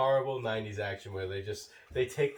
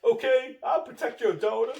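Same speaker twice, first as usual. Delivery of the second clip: high mock voice; 13 semitones higher, 7 dB louder than usual. Answering in a different character voice.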